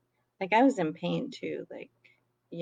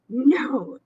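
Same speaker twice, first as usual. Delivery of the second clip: higher, louder exclaimed no; retold loud refusal; 6 semitones higher, 7 dB louder than usual. Grumpy customer refuses the kind manager, exclaiming. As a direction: re-enact a firm, rude refusal. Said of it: "that's how loud I'm exclaiming".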